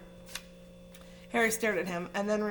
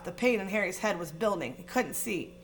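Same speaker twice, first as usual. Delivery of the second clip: usual speaking voice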